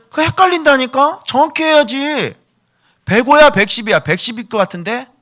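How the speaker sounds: very high, loud squawking complaint; hammed-up shrieky squabble; exasperated insistence. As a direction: nag at the top of your voice. Acted size quarrel reaches its peak.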